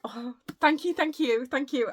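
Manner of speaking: high, loud, repeated burst of gratitude; gushing thanks. Real, not acted. Vlogger thanking a friend for birthday gifts, gushing. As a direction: pour out real excited thanks.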